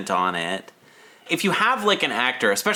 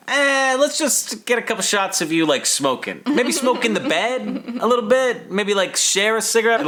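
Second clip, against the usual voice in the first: mock director; 10 semitones higher than usual. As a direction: ham up a pushy director setting up shots. Mocking the director's instructions.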